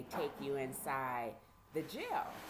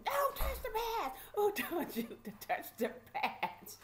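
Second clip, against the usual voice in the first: mock outrage breaking into laughter; imitated scolding holler. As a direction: mimic a sheriff telling someone off. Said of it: "screaming and hollering at us".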